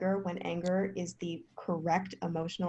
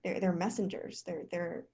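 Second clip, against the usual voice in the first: usual speaking voice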